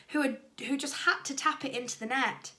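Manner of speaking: usual speaking voice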